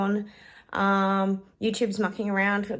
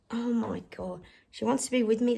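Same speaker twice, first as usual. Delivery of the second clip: usual speaking voice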